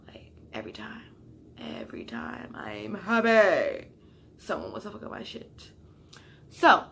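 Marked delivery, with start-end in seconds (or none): 0.1-5.7 whispered, defeated complaint; soft voice, near tears; hushed bitter whisper. Real, not acted. Upset, crying outside.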